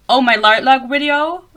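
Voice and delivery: loud, drawn-out "Lightlark video" with a foreign accent; accent slipping out. Real, not acted. Podcaster exclaiming over own video views, accent slipping. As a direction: let the accent slip, blurring "Lightlark video". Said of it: "my accent is really coming out".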